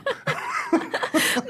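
very high squealing laugh; shrieking laugh. Acted. Couple mocking neighbor's crafts.

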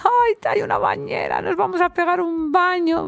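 retelling her thrilled reaction to finding a bathtub; excited squeal. Retold or imitated. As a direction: gush over the bathtub like a kid.

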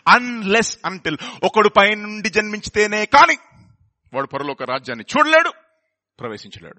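rapid quoting in Telugu; Telugu preaching. Retold or imitated. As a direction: rattle off the Telugu verse as in church. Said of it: "telling this in the Telugu church".

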